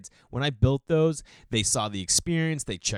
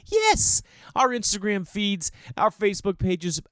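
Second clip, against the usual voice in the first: much higher, sing-song ironic chirp; mock cheery; 12 semitones higher, 3 dB louder than usual. Parent mocking the perfect lives shown online, ironic chirp. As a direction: gush it like a perfect Instagram post.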